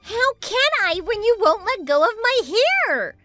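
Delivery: high, exasperated whine; pained protest. Acted. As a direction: whine back while held by the hair. Hair pulled in acted fight.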